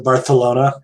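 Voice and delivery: Barcelona with a TH for the C; Barcelona lisped the Spain way, "Barthelona"; Castilian lisp. Shown as a demonstration. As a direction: say Barcelona as "Bar-the-LO-na". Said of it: "the lisp".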